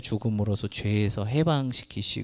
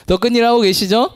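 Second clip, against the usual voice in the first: high, loud rising call to the crowd; call for amen; 9 semitones higher, 8 dB louder than usual. Shown as a demonstration. Pastor demanding an amen from the congregation, high and loud. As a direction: pump them up for an amen. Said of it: "안 돼도 아멘 이때 하는 거예요".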